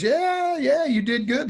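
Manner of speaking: lightly voiced gruff blues star, pleased. Blues star praising the young player after his set, lightly imitated. Voicing [name]'s praise.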